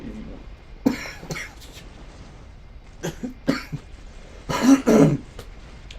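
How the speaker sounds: long rough hacking cough; coughing fit. Real, not acted. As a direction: cough hard and raspy. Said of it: "That comment is hurting my health".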